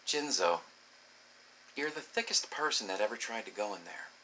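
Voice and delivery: soft, hushed imitation of a partner; gentle confiding. Partner holding his face explains gently, softly confiding. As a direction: break it to them quietly.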